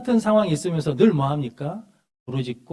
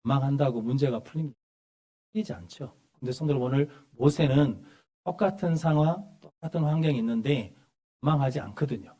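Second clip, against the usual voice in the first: ordinary talk, nothing marked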